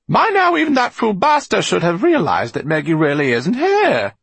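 very high, loud indignant character voice; exasperated Eleanor. A narrator's reading. Eleanor to fellow prisoners about that fool Basta, exasperated. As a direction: burst out high and loud at the others.

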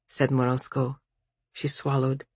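usual speaking voice

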